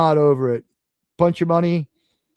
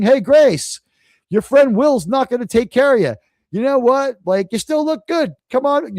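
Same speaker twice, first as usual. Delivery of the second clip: higher, cheering her up; calling out; 6 semitones higher than usual. Acted. Calling out to actress stuck in car, higher and cheery. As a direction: shout encouragement to a stuck friend.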